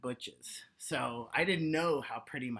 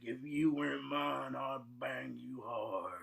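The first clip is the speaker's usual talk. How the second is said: softer, lower, slow come-on; mock seduction; 3 semitones lower, 5 dB quieter, slower than usual. Comic flirting with own reflection, soft slow mock seduction. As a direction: murmur it like a lover to the mirror.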